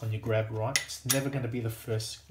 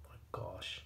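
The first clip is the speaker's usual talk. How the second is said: breathy whisper of dismay, softer; 10 dB quieter than usual. Real, not acted. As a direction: whisper it, staring at the mess. Sees too much oil.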